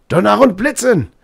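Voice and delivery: high, loud outburst of indignation; angry shout. A narrator's reading. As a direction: thunder out the curse angrily. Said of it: "shouted the professor angrily".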